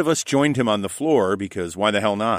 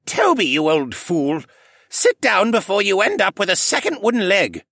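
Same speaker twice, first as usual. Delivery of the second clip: put-on slushy tipsy old koala; 11 semitones higher, 4 dB louder than usual. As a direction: tease his friend like a tipsy old man. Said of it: "Jenner's slightly slushy voice".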